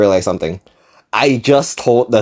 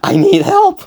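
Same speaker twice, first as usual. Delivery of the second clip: higher-pitched hammed-up alarm, peaking at the end; mock frantic screaming; 6 semitones higher than usual. Son texting dad about a stranger talking, mock frantic scream. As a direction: build to a squeaky plea for rescue. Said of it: "screaming at my dad in text".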